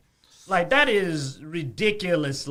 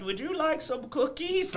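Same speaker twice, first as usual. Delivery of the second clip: lightly voiced sweet old granny, higher; grandma voice; 8 semitones higher, 5 dB quieter than usual. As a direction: offer cookies like a gentle grandma. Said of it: "doing a grandma voice the whole time".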